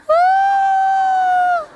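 high, loud thrilled shriek; girl screaming with delight down the slide; excited scream. Retold or imitated. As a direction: shriek with joy coming off the slide. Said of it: "She's like".

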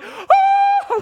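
very high, loud shocked yelp; high panicked yelp; startled yelp. Retold or imitated. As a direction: yelp in alarm at the spider. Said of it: "I'm like".

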